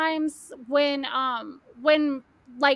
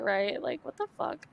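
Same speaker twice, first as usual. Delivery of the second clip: usual speaking voice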